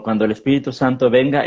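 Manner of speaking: ordinary talk, nothing marked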